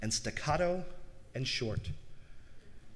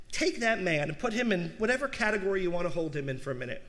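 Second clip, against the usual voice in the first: normal talking voice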